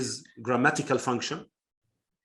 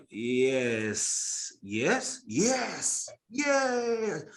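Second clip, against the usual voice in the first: yes repeated, climbing higher each time; yes in different tunes; 5 semitones higher, much slower than usual. Shown as a demonstration. Teacher demonstrating feelings through yes. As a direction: run yes up the scale, each one higher. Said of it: "something that is boring".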